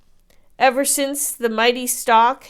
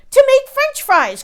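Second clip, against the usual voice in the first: much higher, louder eager shout; excited cry; 10 dB louder than usual. A narrator's reading. Jack excitedly answers the giant. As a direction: blurt it with delight. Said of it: "cried Jack".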